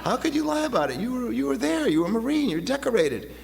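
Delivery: can't believe what you just heard; incredulous. Retold or imitated. Stunned that decorated Marine lied.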